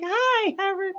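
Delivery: high, sugary flirt voice, with the last words going soft; imitating a hammy flirt; cheesy pickup line. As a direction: lay on a mock pickup line. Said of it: "I'm like".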